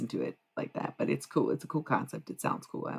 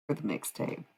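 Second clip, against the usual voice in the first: usual speaking voice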